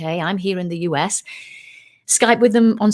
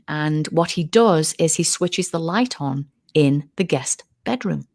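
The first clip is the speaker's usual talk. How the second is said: normal talking voice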